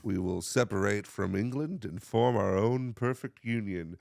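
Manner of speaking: slow, low, formal orator; founding father. Acted. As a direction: deliver it like a school-video founding father. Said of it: "someone talking like this".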